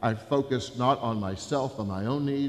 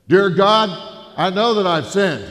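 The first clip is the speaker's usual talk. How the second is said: booming, much louder and higher, every word carried across the room; loud repeat; 6 semitones higher, 12 dB louder than usual. Retold or imitated. Jerry booms the prayer back across the burger joint, loud. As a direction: bellow the prayer back like a hard-of-hearing biker. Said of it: "his hearing was bad".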